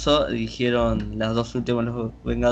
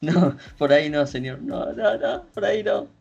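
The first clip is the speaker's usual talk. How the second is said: high squealing protest, no no no; mock panicked patient; 3 semitones higher than usual. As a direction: plead like a scared patient.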